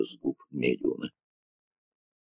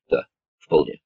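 low, hoarse reply; hoarse from strain; 5 semitones lower than usual. A narrator's reading. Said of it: "охрипшим голосом подхватил её брат".

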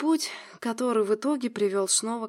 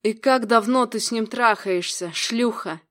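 loud jealous demon snarl; angry accusation; 6 dB louder than usual. Acted. Drunken demon accuses her.

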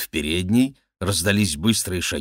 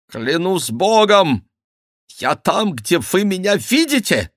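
high, loud, exasperated cry; indignant wail; 10 semitones higher, 4 dB louder than usual. A narrator's reading. Hidden Schomberg answers his searching friends, crying out high. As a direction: cry out in a high voice, drawing out the oath. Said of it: "возопил тот же голос".

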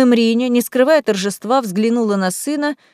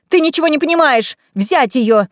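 higher, louder, fast angry command; furious order; 5 semitones higher, 3 dB louder than usual. A narrator's reading. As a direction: shout the guards into action. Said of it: "приказала Мэриня стражне".

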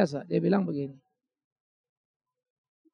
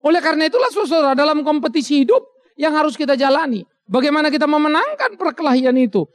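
higher, louder preaching build; rising sermon climax; 7 semitones higher, 5 dB louder than usual. Acted. Preacher rallying his congregation, louder and higher. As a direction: drive the congregation to the peak.

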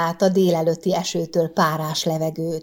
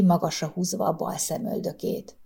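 usual speaking voice

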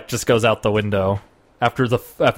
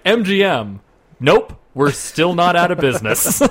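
mock announcement; 6 semitones higher, 4 dB louder than usual. Mock announcing studio logo to friend.

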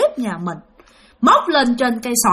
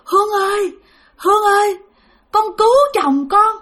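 pleading spirit father-in-law; 8 semitones higher than usual. Retold or imitated. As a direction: beg a daughter-in-law for rescue. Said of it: "Nói với mẹ bạn vậy nè".

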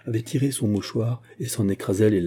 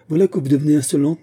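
higher, louder, fast scolding; indignant outburst; 6 semitones higher, 6 dB louder, faster than usual. Acted. Henriette rebukes the insolent shop girl, indignant and loud. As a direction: bristle and lash out quickly. Quarrel between two women.

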